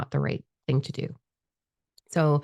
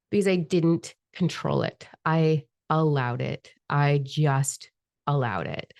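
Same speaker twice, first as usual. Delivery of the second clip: ordinary talk, nothing marked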